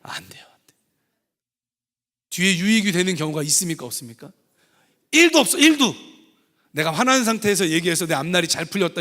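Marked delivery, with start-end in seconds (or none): 0.0-0.8 a hushed whisper, shaking the head; whispered warning to the congregation. Real, not acted. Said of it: "이렇게 화난 상태에서는 말하면 돼요". 5.1-5.9 much higher and louder exclaim; loud emphatic denial to the church. Real, not acted.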